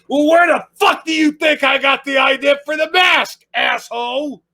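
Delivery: high, loud comic outburst; mock-furious yell from the chest; chest-straining yell. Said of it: "that one hurt my chest".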